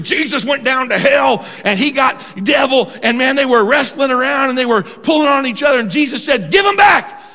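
re-enacted hellfire storytelling, rising to a shout; mock pulpit bellow, building to a high yell; old-time preaching. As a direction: imitate a fired-up preacher, pitched high. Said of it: "I remember preaching".

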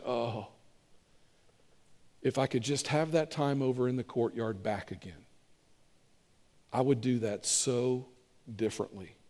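low, hushed regretful musing; wistful regret. Acted. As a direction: sigh it softly, wishing for a do-over. Voices Peter's regret over his denial.

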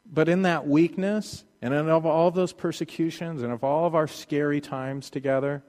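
ordinary delivery

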